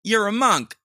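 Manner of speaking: loud, high mimic of the woman; mocking imitation of woman. Host retells her favorite insult from the video, loud mimicry. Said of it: "She goes".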